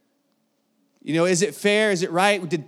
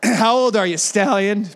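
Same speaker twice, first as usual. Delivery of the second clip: laughing through it; 4 semitones higher, 6 dB louder than usual. Retold or imitated. Retelling lifeguard question, laughing.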